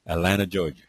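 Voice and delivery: "Atlanta" as uh-LAN-uh, then Georgia; like a Georgia local. Shown as a demonstration. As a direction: drop both t's in "Atlanta", Georgia-style. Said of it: "You say it like you're from Georgia".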